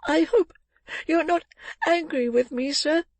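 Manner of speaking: put-on very high, pleading child's voice; small boy begging not to be blamed; beseeching little boy. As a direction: plead timidly, high and halting. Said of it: "raising his eyes beseechingly".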